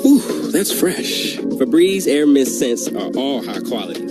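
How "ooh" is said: ordinary delivery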